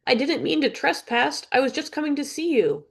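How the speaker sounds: put-on high girlish plea; girl pleading.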